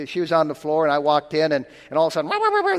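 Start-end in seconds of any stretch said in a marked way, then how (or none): 2.3-2.8 a very high yappy mock bark; imitated dog barking. Preacher imitates a little dog barking at him, high mock yapping. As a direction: yap like a small dog. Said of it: "the dog started barking at me".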